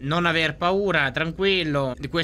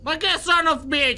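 high, raised-voice exclamation; indignant echo; 10 semitones higher, 3 dB louder than usual. Real, not acted. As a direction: throw the curse back loudly, voice shooting up. Echoes game character's curse.

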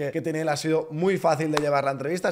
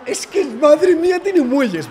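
shouting in amazement; excited shout; 12 semitones higher, 10 dB louder, much slower than usual. Real, not acted. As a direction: yell it out, blown away. Reacting after countdown to dunk.

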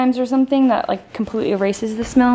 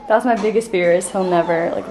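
normal talking voice

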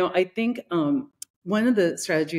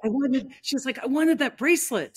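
mock-annoyed tourist wailing over the lost bracelet; frustrated whine; 7 semitones higher than usual. Tourist to her friend, whining after missing the bracelet.

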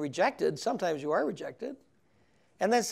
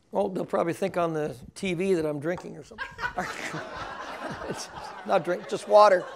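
real laughter running through quick words; joking to the TV audience while laughing. Real, not acted. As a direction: chuckle at his own joke. Joking about drinking on TV.

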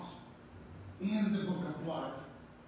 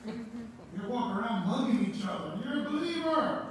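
raised, ringing pulpit voice; fervent preaching; 4 semitones higher, 4 dB louder than usual. Acted. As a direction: preach it out to the congregation, building. Full-force preaching to congregation.

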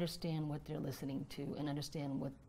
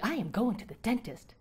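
higher and louder, punching I; keen, stressed I am going; eager and emphatic; 7 semitones higher, 8 dB louder than usual. Shown as a demonstration. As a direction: announce the dentist trip with gusto. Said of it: "Perhaps it could be".

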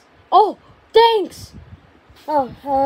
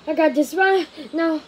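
ordinary delivery

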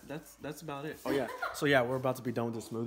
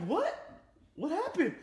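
high, quick mock alarm; startled question; 13 semitones higher than usual. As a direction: jump in surprised and worried. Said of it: "I'm like".

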